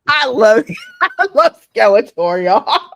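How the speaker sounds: loud, high, real laughter through the words; laughing hard. Real, not acted. Host cracking up at a Skeletor clip, laughing. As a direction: gush while cracking up.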